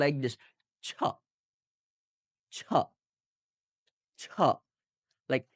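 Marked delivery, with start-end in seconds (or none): 0.9-4.2 ছ said as a sharp airy burst; aspirated ch. Shown as a demonstration. Teacher demonstrates the correct sound, ছ with aspiration. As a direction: puff air out on each isolated ছ. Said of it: "চ যুক্ত ছ দিয়ে বলতে হবে".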